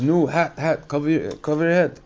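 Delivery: lightly voiced foreign man urging; mock foreign man. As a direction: play the pushy stranger pointing at your head. Bukharian man insisting on head cover.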